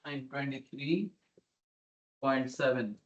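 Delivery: quiet murmur, reading back a number; murmured while writing. Real, not acted. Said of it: "let me write that down".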